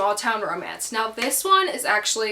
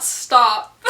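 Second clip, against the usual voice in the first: loud stop; 8 dB louder than usual. Real, not acted. Scolding herself, laughing.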